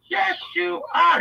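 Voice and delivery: high, slow gushing reassurance; Leslie Jennings. Acted. Admirer assuring him he's a leader, gushing. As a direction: coo it in a high put-on voice. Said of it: "doing that Leslie Jennings voice".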